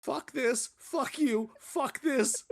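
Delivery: high mock-furious rant, voice climbing; imitated outburst. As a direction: play the guest losing it over the sauce. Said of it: "She goes".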